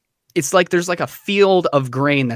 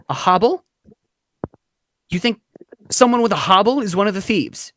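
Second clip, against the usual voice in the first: mildly higher, halting disbelief; wide-eyed realization; 6 semitones higher, faster than usual. Acted. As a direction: question it with a touch of shock. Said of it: "wide-eyed look on her face".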